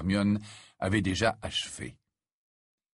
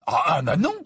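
higher, louder stammered dismay; horrified exclamation; 6 semitones higher, 6 dB louder than usual. A narrator's reading. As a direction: exclaim in dismay, stumbling on the first words. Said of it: "s'exclama Ron".

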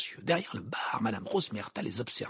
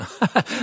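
short, high, loud cackle; scornful stage laugh; mocking laugh; 10 semitones higher, 7 dB louder than usual. Rita laughs at Hermione's hair, mocking.

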